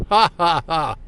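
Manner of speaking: spelt-out gloating "ha, ha, ha"; taunting fake laugh, separate beats; mocking laugh. Man taunting the woman left behind, mocking laugh.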